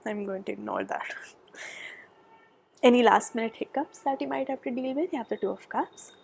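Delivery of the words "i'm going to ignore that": whisper. Real, not acted. Hushed dismissal, reading on.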